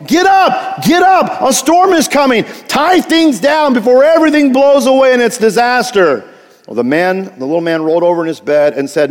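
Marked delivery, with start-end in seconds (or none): 0.1-6.2 acted high-pitched yell of alarm; urgent yell. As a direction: yell to rouse a sleeper before the storm. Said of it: "he shook him and he yelled".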